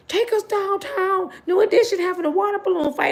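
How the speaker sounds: high, whiny begging voice; pleading teen girl. Retold or imitated. Girl begging her brother for a ride, high and pleading. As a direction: beg big brother like an eager kid.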